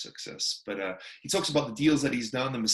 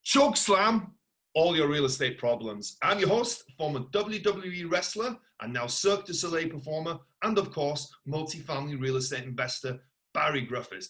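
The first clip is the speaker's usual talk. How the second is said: ring-announcer style welcome, higher and punched; show announcer intro; 4 semitones higher, 4 dB louder than usual. Acted. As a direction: announce it like a wrestling host, hitting the catchphrase. Host's rehearsed show opening.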